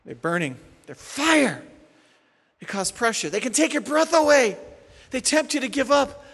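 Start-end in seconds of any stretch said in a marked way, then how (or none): none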